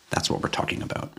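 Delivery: breathy whisper under the breath; whispered aside. Real, not acted. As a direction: whisper it like a secret. Whispered aside between slogans.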